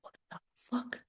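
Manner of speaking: hushed whisper of horror; stunned whisper; whispered shock. Retold or imitated. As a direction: breathe it like you can't believe it. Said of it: "I'm like".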